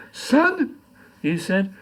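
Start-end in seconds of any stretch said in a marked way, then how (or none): 0.1-0.8 a high, hushed voice asking son; a lightly voiced dying old man calling out. Dying man speaks in poem.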